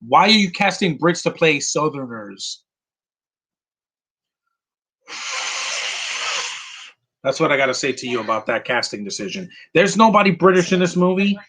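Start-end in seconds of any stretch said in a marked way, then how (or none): 5.1-6.6 long, voiceless blast of breath; long dismissive exhale. Acted. Answering a bad casting decision, long dismissive exhale. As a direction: blow out air as your whole answer.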